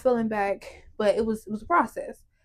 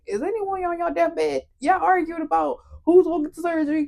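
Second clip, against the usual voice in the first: exasperated; 6 semitones higher than usual. Retold or imitated. Exasperated at arguing family.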